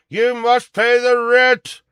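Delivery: loud, high, slow melodrama villain; mustache twirling villain. Acted. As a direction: boom it like a silent-film villain. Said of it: "I just did a play for y'all".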